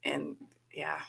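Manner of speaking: unvoiced trailing whisper. Real, not acted. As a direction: let it fade to a whisper.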